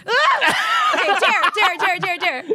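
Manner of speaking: high laughing squeal into chanting dare; giddy shriek; excited squeal. Real, not acted. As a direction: burst out squealing then chant. Picked, reacts excitedly.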